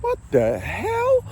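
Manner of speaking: high, disbelieving cry; hearing dad's advice, squeaky outraged disbelief; incredulous shock. Retold or imitated. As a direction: squeal in disbelief at the old man. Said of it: "I'm like".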